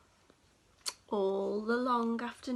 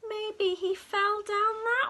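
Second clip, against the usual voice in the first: wailing; 7 semitones higher, 5 dB louder than usual. Acted. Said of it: "wailed the cat".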